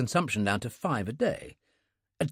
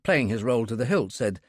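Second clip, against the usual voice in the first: usual speaking voice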